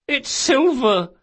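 high, slow, feigned weeping; tearful burble. Acted. Boy to old woman, high tearful burble. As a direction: burble it out through put-on tears. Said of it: "Locke burbled".

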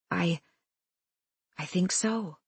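soft, careful and hesitant; careful and calm. A narrator's reading. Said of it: "Careful words, calm voice".

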